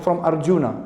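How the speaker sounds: ordinary talk, nothing marked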